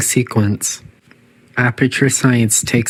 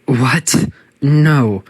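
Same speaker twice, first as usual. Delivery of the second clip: startled AI; 3 semitones higher, much slower than usual. Acted. AI startled when subject escapes.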